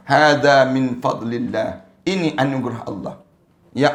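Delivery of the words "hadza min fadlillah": slow, deliberate Arabic phrase; slow recitation. Shown as a demonstration. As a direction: recite it slowly and reverently. (bacaan perlahan dan khusyuk)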